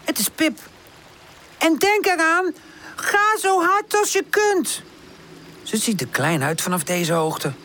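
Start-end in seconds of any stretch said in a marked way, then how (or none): 1.6-4.8 high, drawn-out call; high-pitched urging, stretched words; calling from afar. A narrator's reading. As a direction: call advice across a distance. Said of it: "klinkt het van ver".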